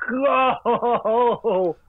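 long high-pitched mock wail; drawn-out frustrated howl; exasperated wail. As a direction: draw it out in comic despair. Wailing in frustration at internet outage.

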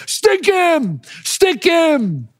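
high, hammering shouts; urgent yell. Retold or imitated. Father pounding the mat for his son, urgent yelling. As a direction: slam the words down like a fist on the mat.

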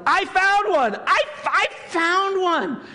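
high, loud triumphant shout; excited yell. Retold or imitated. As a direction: yell out the find, stretching it high. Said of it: "And I yelled".

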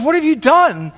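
high, alarmed exclamation; horrified outcry. Acted. Paramedic confronting bystanders who removed the tourniquet, horrified. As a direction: cry out in dismayed disbelief.

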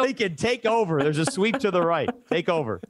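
mock frantic handoff. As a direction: toss the call to Lincoln in a comic panic. Joking handoff mid-broadcast play.

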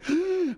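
sharp, high-pitched gasp; mock gasp of craving; anxious gasp. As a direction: gasp as if itching for the phone. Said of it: "ya es como de".

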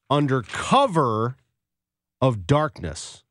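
"under cover ... of darkness" drawn out slowly with a pause; "under cover" and "of darkness" as two slow, weighty pieces; dramatic quote emphasis. Retold or imitated. Quoting the damning phrase for effect.

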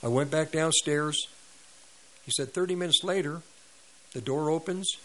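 ordinary delivery

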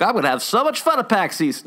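high, put-on gloating voice; mocking gloating attendee. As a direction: mimic someone bragging about their plans. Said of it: "be like".

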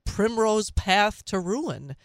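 ordinary talk, nothing marked

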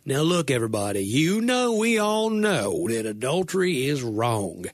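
mock preacher disclaimer with an Alabama twang; Southern Alabama drawl, pitch swooping up on "you know"; put-on Alabama accent. Preacher parodying his own disclaimer as Jesus, exaggerated Alabama accent. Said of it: "You have to say it in that accent".

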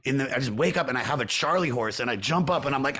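ordinary talk

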